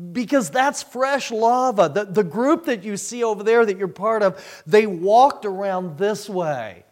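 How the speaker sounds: loud, high, emphatic warning; urgent explaining. Retold or imitated. Telling the stray walker about the lava, loud urgency. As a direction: call it across to someone in danger.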